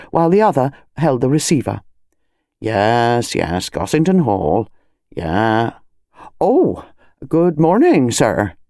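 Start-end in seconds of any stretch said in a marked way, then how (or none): 2.6-8.5 lower, slower, respectful; deferential constable. Acted. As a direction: soften into deference for the squire. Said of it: "tone underwent a slight modification".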